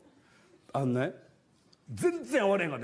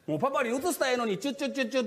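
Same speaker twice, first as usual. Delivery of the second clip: put-on doting baby talk; 7 semitones higher, faster than usual. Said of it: "ってやっとったら".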